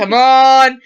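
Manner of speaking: loud, drawn-out mock pleading; urgent plea. Finn begging the droid to play along, loud mock plea.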